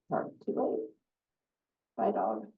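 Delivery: unvoiced whispered farewell; whispered goodbye to the dog. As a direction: breathe it out as a hushed aside. Aside after dog's death.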